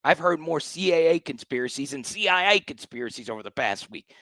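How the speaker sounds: ordinary delivery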